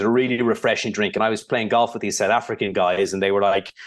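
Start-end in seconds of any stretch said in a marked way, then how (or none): none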